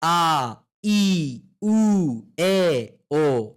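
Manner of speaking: vowels called out loud and clear; loud vowel drill at the mirror; loudly. Shown as a demonstration. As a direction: belt each vowel like a drill. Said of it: "loudly mirror के सामने बोलिए".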